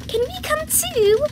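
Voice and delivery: put-on very high, eager child voice; Peppa Pig. A narrator's reading. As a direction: squeak it out as a small piglet. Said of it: "Peppa said".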